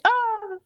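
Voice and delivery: high-pitched mock "Ah!" of shock; shocked fainting cry. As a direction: imitate someone crying out as they faint. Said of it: "faints from shock".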